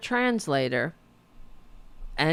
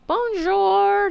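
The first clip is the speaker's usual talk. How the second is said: loud, high, drawn-out bonjour; hammed-up, high-pitched French hello; mock French greeting; 6 dB louder than usual. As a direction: call it out in a mocking singsong. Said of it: "she goes".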